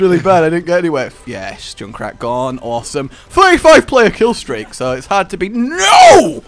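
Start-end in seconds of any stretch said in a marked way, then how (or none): 5.5-6.4 high, loud outburst; dismayed yell. Real, not acted. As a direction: cry out at a sudden setback. Sudden setback in game.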